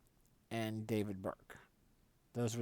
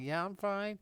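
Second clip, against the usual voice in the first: reassuring; 10 semitones higher than usual. Retold or imitated. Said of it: "I was like".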